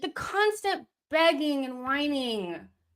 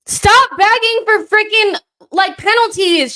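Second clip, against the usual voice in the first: angry shout; 11 semitones higher, 15 dB louder than usual. Real, not acted. Yelling at players during match.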